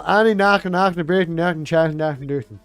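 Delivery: hammed-up Irish sounds; mock Irish. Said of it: "And then Jim's all".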